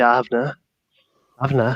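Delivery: broad Geordie dialect, "I have na" said the Newcastle way; Geordie accent. Shown as a demonstration. A Geordie speaking to another Geordie, in broad Geordie accent. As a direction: say "I have na" as a Geordie would to a mate. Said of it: "if I'm talking to another Geordie".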